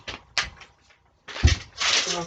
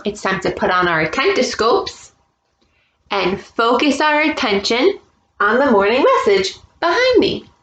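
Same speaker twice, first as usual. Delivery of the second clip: ordinary talk, nothing marked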